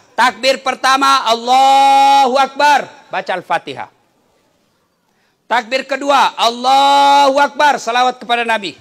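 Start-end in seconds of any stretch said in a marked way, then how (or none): 1.2-2.8 long, slow, loud chanted takbir; takbir demo. Shown as a demonstration. Preacher demonstrates the funeral prayer to the congregation, slow loud chant. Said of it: "Kursus sholat jenazah". 6.4-7.8 long, slow, loud chanted takbir; takbir demo. Shown as a demonstration. Preacher demonstrates the funeral prayer to the congregation, slow loud chant. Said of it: "Kursus sholat jenazah".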